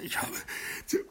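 normal talking voice

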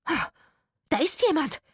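high, loud gasp and shout; alarmed cry. A narrator's reading. Woman hearing someone crash down, alarmed cry. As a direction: shriek the discovery.

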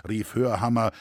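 ordinary delivery

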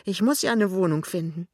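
mildly desperate plea; understated, pleading urgency. A narrator's reading. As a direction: insist with a touch of despair. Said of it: "sagt Lämmchen ganz verzweifelt".

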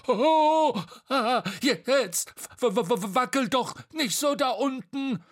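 high, stuttering yelps; wobbly alarm. Acted. Bo wobbling above Tete, high panicky complaint. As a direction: stammer in alarm as balance goes.

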